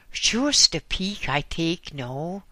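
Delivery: put-on a heavy German accent, pushy; thick German accent. A narrator's reading. German visitor at the door, thick German accent. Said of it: "said a thick guttural voice".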